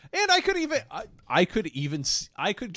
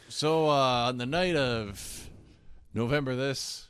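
lightly imitating an elderly singer's halting storytelling; halting, low-key stage patter; low-energy old singer; 4 semitones lower than usual. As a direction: mimic a tired performer introducing a song. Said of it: "So he was like".

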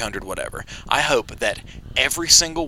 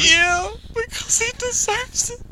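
gushing; slower than usual. Real, not acted. Gushing about couple.

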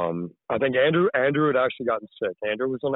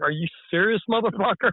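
indignant retort; 10 semitones higher than usual. Retold or imitated. Acts out offended woman's retort.

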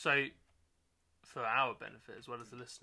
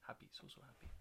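1 breathy Pep; 14 dB quieter than usual. Acted. Pep's odd voice.

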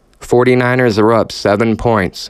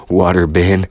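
laughing; 2 semitones lower than usual. Real, not acted. Laughs at own slip.

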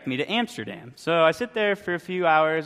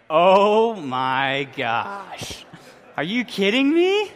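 drawn-out, louder cry of dismay; exasperated disbelief; 7 semitones higher, 5 dB louder, much slower than usual. Retold or imitated. Traveler hearing the flight was yesterday, exasperated disbelief. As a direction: start stretched out, then leap high in disbelief. Reacting to missed-flight news.

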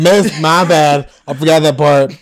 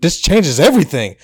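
usual speaking voice

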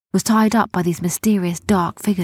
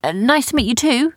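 hesitant, higher-pitched greeting; nervous, rising politeness; a bit terrified; 6 semitones higher than usual. A narrator's reading. Rowan greeting pirate girl after ordeal, slightly scared. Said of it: "who was a little bit terrified".

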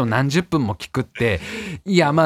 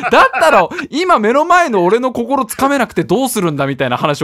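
a heated, higher, louder pitch to win him over; fired-up lecture; 7 semitones higher, 4 dB louder than usual. Retold or imitated. Retelling how he lectured the salesman, a fired-up push. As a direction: get carried away preaching to the salesman. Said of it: "よくわかんないスイッチ入っちゃってるから".

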